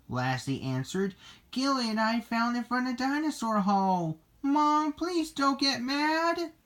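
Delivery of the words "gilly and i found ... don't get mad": high-pitched pleading; pleading confession. Acted. Lassie begs her mom not to be mad, high pleading. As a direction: plead high, slowing at the end.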